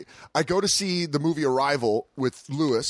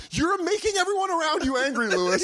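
pitched way up, fed-up scolding; exasperated high complaint; 11 semitones higher than usual. Retold or imitated. Friend scolding Lewis for angering everyone, high exasperated whine. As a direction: whine it high at him like you're at your limit. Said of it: "he just does shit where you're like".

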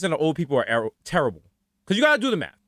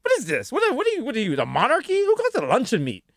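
high-pitched, incredulous comeback; retold bickering, pitched up; mock indignation; 11 semitones higher, faster than usual. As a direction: act out affronted disbelief. Acted argument with his aunt.

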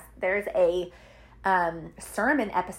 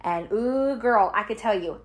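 usual speaking voice

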